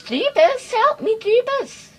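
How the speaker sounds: high-pitched, loud cry for help; play-panicked high yelp; mock desperate plea. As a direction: squeal for rescue in mock panic. Mock plea while stuck in game.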